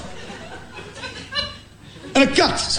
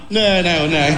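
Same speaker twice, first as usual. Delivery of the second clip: nervous denial; 7 semitones lower, slower than usual. Retold or imitated. Nervous denial about the cat.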